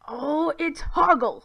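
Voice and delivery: a touch of irritated dwarf voice; lightly voiced annoyed dwarf; hissed correction. A narrator's reading. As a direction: correct the name testily. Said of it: "Hoggle hissed".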